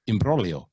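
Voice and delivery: "imbroglio" said "im-BROH-lio" with the G silent; Italian "imbroglio", no G sound. Shown as a demonstration. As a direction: drop the G in "imbroglio", Italian style. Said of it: "the G before another consonant is usually silent".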